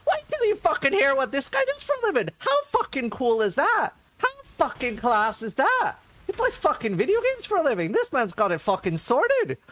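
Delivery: very high, loud and fast gushing; excited bloke. Retold or imitated. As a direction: gush like a thrilled fan. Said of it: "calls his wife over".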